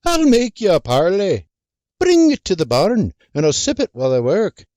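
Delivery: put-on sly old man. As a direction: strike a deal like a shady pirate, then scurry. Arthur bargains, slinks off.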